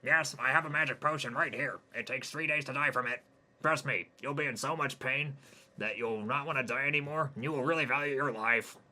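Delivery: eerie alien friend asking. Acted. Jimmy the alien asks Hayden about dying.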